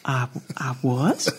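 slow, low, soft imitated reply; modest admission. Songwriter admitting his nomination to a stranger, imitated slow modest reply. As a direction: draw it out low and reluctantly.

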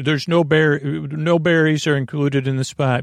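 usual speaking voice

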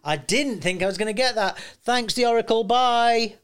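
gleeful thanks after an unexpected win; ecstatic. Real, not acted. Host thanks the Oracle for picking his actor, ecstatic. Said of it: "obviously I'm ecstatic".

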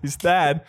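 "sad" turned into "Thad"; mock lisp on "Thad". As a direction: repeat the lisp, "th" opening "Thad". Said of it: "he had a lisp".

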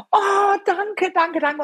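high-pitched gushing gratitude; acted-out rush of relief; retold effusive thanks. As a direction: thank him over and over, overjoyed. Re-enacts thanking the doctor.

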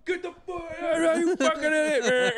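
a mock furious drive-in crowd; mock angry yell. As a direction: yell at the idiot with his high beams on. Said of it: "everybody would be like".

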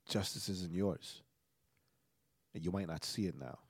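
low, soft, with long pauses; imitating her quiet, measured reassurance; soft spoken. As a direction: counsel gently, without raising the voice. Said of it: "She, like, real soft spoken".

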